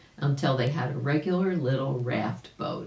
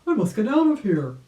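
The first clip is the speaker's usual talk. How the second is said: loud, high alarmed cry; urgent rabbit calling out in alarm; 7 semitones higher, 7 dB louder than usual. A narrator's reading. Uncle Wiggily in rising water, crying out loud and high. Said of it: "cried Uncle Wiggily".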